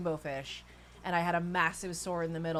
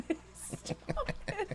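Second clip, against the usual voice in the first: breathless giggling "stop it" when tickled; being tickled; 4 dB quieter, slower than usual. Real, not acted. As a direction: squeal and laugh while being tickled. He's not tickling me.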